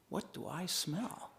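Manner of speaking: slow, hushed, wondering; a hushed, drawn-out question to himself; puzzled sniff. Retold or imitated. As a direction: ask it quietly as if catching a scent. Director sniffing at the open window.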